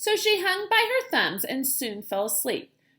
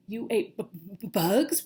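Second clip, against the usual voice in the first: stammering; 3 semitones lower than usual. Acted. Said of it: "one stammered".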